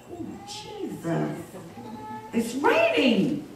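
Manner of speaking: drawn-out, hushed dismay. Retold or imitated. Dismayed reaction on seeing rain.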